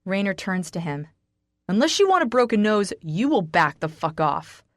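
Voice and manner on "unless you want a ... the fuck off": loud warning; menacing threat. Acted. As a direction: threaten him hard. Raynor threatens Jack.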